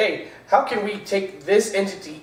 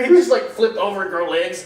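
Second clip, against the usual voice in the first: ordinary talk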